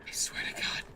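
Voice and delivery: breathy whispered oath. Real, not acted. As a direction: whisper it through exasperation. Muttering in frustration.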